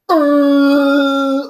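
long, loud, high held honk; imitated car horn. Friend honking from the cross street, imitated horn blare. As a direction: blare like someone leaning on the horn.

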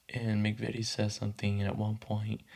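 ordinary talk

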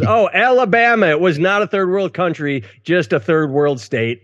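high-pitched feigned discovery; put-on "oh, my mistake" lift; mock surprise. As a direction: correct yourself in pretend astonishment.

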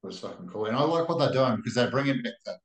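usual speaking voice

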